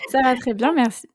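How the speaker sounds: breaking into laughter mid-greeting; laughing retake. Real, not acted. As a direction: say it while still laughing at the slip. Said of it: "On la refait".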